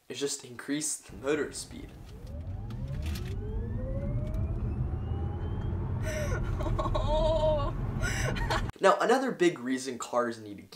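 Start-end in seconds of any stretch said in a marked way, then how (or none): 1.9-8.8 a long, high-pitched burst of laughter. Real, not acted. Cracking up while explaining Tesla gears, a long high laugh. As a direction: break into laughing at the idea.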